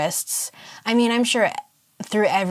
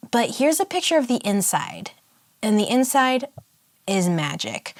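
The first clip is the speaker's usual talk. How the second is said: normal talking voice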